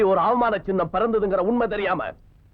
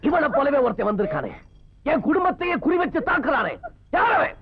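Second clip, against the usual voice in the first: building to a yell; 8 semitones higher than usual. Acted. Brother demands who the new enemy is, rising to a furious shout. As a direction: explode on the question.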